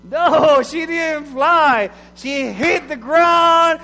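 dramatic proclamation. Acted. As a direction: boom it out slowly to the congregation. Dramatic climax of the fable.

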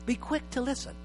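"Be quick to listen" said softly, almost hushed; soft repeat. Shown as a demonstration. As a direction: pastor repeats the verse quietly for it to sink in. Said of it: "So James said".

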